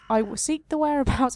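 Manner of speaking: the tree face answering the hero; lightly voiced tree. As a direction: slip back into the tree voice. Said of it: "keep that voice up now".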